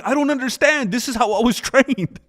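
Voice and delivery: high, pleading imitation of an innocent; mock sincere confusion. Imitating fouling fighter defending his training, mock sincere confusion. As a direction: protest high, as if honestly puzzled. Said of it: "He does it so honestly and sincerely".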